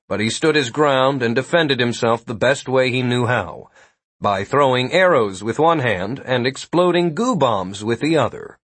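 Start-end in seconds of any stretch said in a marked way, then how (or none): none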